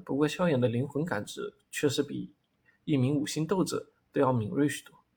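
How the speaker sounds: ordinary delivery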